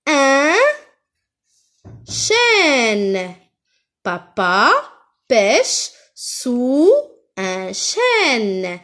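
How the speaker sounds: very drawn-out, separated words; word-by-word modelling. Shown as a demonstration. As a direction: stretch each word for learners. Teacher models sentence word by word. (phrase lue mot à mot, très lentement)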